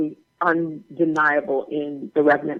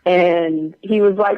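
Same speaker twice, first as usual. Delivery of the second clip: usual speaking voice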